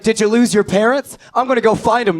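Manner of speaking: high-pitched, eager busybody; voiced little person. Little person to lost child, eager offer to help.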